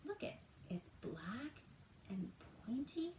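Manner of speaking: hushed, breathy storyteller reveal; suspense whisper. Acted. Reading to kids, building suspense in a whisper. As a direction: whisper it like a secret clue.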